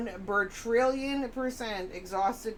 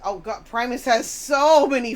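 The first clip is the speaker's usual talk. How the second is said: much louder groaning complaint; mock exasperation; 3 semitones higher, 11 dB louder than usual. Imitating a fed-up music fan ranting to a friend.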